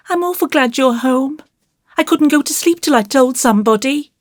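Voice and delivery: put-on high, plaintive child's voice; unhappy little boy. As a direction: pour it out like a troubled small boy. Voices unhappy young Davy.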